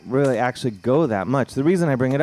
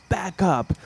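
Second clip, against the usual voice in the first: homeless guy yelling; 9 semitones higher than usual. Retold or imitated. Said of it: "he's like".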